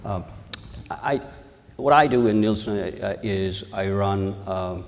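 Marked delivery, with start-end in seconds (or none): none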